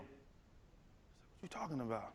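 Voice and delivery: hushed dad. Retold or imitated. Dad puzzled by son.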